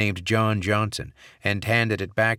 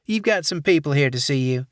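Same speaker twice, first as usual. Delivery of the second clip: put-on Southern accent; 7 semitones higher, 5 dB louder than usual. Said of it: "saccharine Southern accent".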